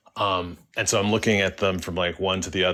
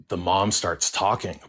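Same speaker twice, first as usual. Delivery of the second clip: usual speaking voice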